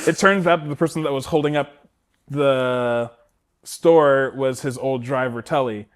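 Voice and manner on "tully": usual speaking voice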